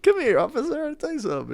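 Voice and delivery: high-pitched, lightly hammed-up leprechaun; high mock coaxing voice. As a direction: imitate a leprechaun coaxing someone. Acts the leprechaun luring officer.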